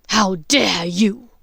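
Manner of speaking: slow, loud werewolf snarl; a furious snarl, drawn out slow. A narrator's reading. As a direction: spit each word out like a snarling beast. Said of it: "Greyback snarled at her".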